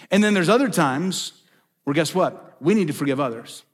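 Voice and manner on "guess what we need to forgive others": ordinary talk, nothing marked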